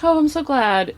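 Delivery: high, cooing imitation of a pleased actress; delighted star. Actress answering a fan's praise, delighted.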